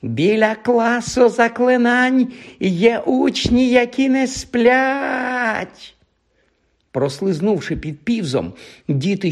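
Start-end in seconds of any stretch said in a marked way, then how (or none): 0.0-5.9 високий протяглий крик, мов сирена; вий на одній високій ноті; верещання сиреною. Acted. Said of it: "заверещав Півз не згірше сирени". (верещить, як сирена)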